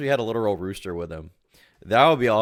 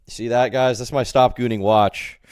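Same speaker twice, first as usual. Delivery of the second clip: ordinary delivery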